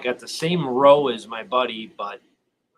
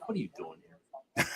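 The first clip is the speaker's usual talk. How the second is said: low, softer private wondering under his breath; thinking to himself; 7 semitones lower, 10 dB quieter than usual. Retold or imitated. Watching rival fans in his section, quietly thinking to himself. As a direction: mutter it as an inner thought. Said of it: "I'm just thinking to myself".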